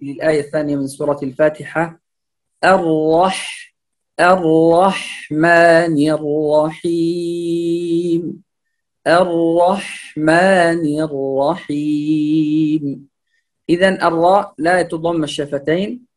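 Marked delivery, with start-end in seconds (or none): 2.6-12.8 slow, measured tajweed reading; Quran recitation. Shown as a demonstration. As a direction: recite with heavy r and light m. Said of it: "القراءة الصحيحة". (تلاوة مجودة بطيئة)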